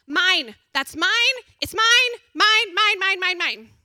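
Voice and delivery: put-on loud, high whiny toddler; possessive whining. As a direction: whine it like a grabby toddler. Toddler voiced claiming things.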